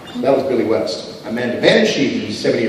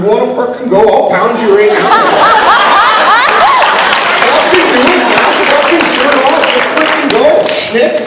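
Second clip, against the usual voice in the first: rowdy Canadian brawler; 11 semitones higher, 7 dB louder than usual. Pretend. Showing how Canadians really talk, brawler style. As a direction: pick a bar fight in a Canadian growl. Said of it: "I am Canadian".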